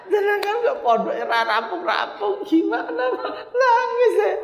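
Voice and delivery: suara tinggi merengek, menirukan istri menangis; menirukan tangis. Retold or imitated. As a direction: rengekan tinggi setengah tertawa. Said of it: "sama Niso pun nangis". (meniru Niso mengeluh pondok tak selesai sambil merengek)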